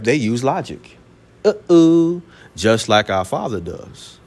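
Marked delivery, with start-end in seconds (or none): none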